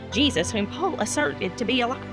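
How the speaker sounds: usual speaking voice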